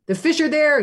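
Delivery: high, loud excited yell. A narrator's reading. Skipper yells to the crew about fish, loud and high. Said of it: "he'd yell".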